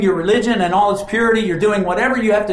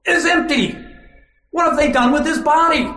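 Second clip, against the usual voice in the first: higher, alarmed disbelief; shocked; 9 semitones higher than usual. Acted. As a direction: gasp it out in alarm at the empty tomb. Mary finding empty tomb.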